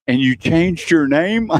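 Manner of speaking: a hammed-up mother asking in slow disbelief; mock mom. Host voices his mother's disbelief.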